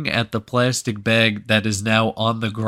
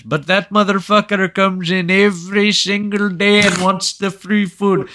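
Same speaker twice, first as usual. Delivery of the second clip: put-on high, emphatic Indian-accented shopkeeper; insistent mock Indian accent, pitched up; 9 semitones higher, 5 dB louder than usual. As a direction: imitate an Indian counterman making his case. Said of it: "the Indian guy behind the counter".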